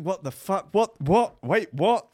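rising high startled whats; shocked confusion. Acted. Reading the first messages, rising high startled whats.

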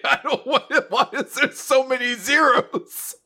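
high, laughing whine of a lost freshman; whiny confused voice. Retold or imitated. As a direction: whine it out through a laugh, baffled by zeros. Retold confused self at binary quiz.

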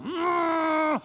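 shouted imitation of a horn; mock horn blast. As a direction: blare it like a horn. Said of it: "I just lay on the horn".

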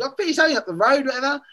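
sing-song tease of the newcomer; teasing player. Retold or imitated. Player mocking him, others laughing.

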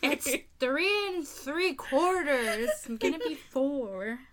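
put-on slow, laughing, childlike three-year-old; mock kid voice, giggling; playful little-kid voice. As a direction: play a proud toddler telling her age. Jokes about being a child.

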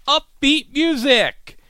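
high, slow sing-song; mock upbeat caption read. Vlogger mocking the closed caption, sing-song and high. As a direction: read the caption like a cheesy jingle.

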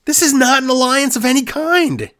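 high-pitched, exasperated outburst; exasperated protest. Real, not acted. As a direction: protest in comic disbelief. Exasperated at the wrestling storyline.

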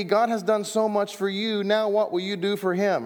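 high, pleading mock preacher appeal; mock preacher plea. Mocking a familiar pulpit appeal.

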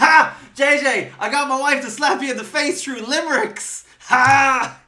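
high, loud triumphant taunting; gloating shout. Real, not acted. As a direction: crow over him loudly.

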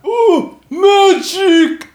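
very high, loud silly monster voice; awestruck monster. Acted. Monster watching a witch bolt fly past, high awestruck squeal. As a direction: squeal it like a delighted creature.

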